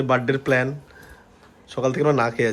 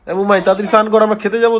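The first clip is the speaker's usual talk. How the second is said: calling out; 8 semitones higher, 8 dB louder than usual. Real, not acted. Calling to someone to hurry.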